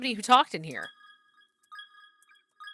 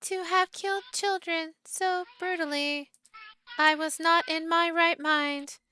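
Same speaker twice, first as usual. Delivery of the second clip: put-on higher, steady monotone like a child's doll; cold robotic child doll; 6 semitones higher than usual. Cursed doll repeats its apology to the players, higher robotic monotone. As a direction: recite the apologies like a hollow wind-up toy. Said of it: "high-pitched voice like that of a child, cold, methodical, and almost robotic monotone".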